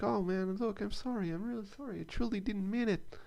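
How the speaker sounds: put-on high-pitched, apologetic kid voice; kid's apology. Fat kid apologizing to the wheelchair guy, high kid voice. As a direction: squeak out a sorry like a scared kid. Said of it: "then the kid's like".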